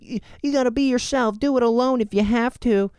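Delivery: high mocking voice. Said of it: "I even said that on here".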